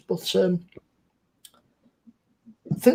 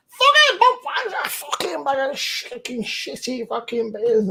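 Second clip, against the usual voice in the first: mock outburst; 4 semitones higher, slower than usual. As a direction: sneer at the cheap beer in a put-on voice. Mock angry quote of beer bag.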